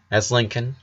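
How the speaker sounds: ordinary delivery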